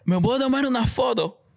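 high, slow, amazed gasp of a fan; starstruck girl. Retold or imitated. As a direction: gush like a starstruck girl asking for a photo. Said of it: "Ella reaccionó y me dijo". (chica emocionada, voz aguda y lenta)

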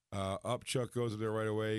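normal talking voice

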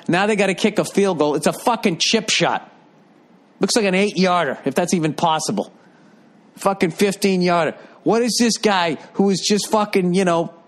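ordinary delivery

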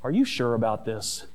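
put-on young son's deadpan doubt to his dad. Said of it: "and he deadpans".

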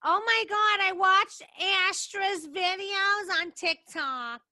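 high, loud gushing mom impression; slow down as mom gets excited; imitating mom. Daughter mimics mom who watches a friend's TikTok, high and loud. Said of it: "She's like".

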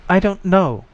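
high-pitched, helpless admission; admitting. A narrator's reading. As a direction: own up in a high voice. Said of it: "the voice admitted".

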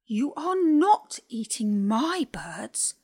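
loud, slow, horrified outburst; cried out. A narrator's reading. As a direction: protect her birds, crying out firmly. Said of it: "she cried".

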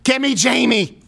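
talking into a tube; calling through a tube; paper cone megaphone. Retold or imitated. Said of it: "He's talking into the tube".

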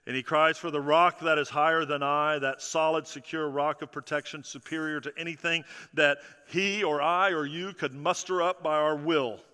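normal talking voice

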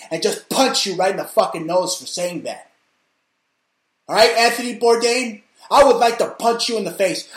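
yelling threats at a celebrity he hates; furious rant. Real, not acted. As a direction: tear into him at full volume. Ranting threat at Bourdain.

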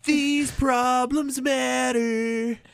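high, very slow melodramatic voice; Stewie teen drama parody. Retold or imitated. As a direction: overdramatic teen, stretch every word. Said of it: "word for word what Stewie says".

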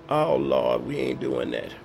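deep mock voice of a friend laughing off church; mocking old friend. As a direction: scoff at the idea in a deep put-on voice. Friends mocking his new faith.

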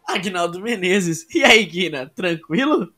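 loud, high announcer intro; big showman welcome; hyped host intro. Acted. Host's loud guest intro.

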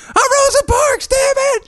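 shrieking teacher. Retold or imitated. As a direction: howl it in a caricatured tantrum. Acting out the teacher being dragged out.

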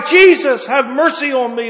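loud pleading cry. Acted. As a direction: cry out to Jesus for mercy. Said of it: "to cry".

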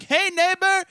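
hammed-up neighborly shout-out; mock cheery neighbor. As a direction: holler over the fence like a proud goofball. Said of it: "I'm gonna fucking be like".

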